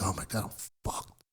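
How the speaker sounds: whispered panic as the jury files in; whispered dread. Retold or imitated. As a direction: breathe it out like a panicked private thought. Inner panic at the verdict.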